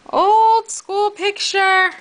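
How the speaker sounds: high, slow drawn-out voice; high silly voice. Pretend. Repeats in a high put-on voice.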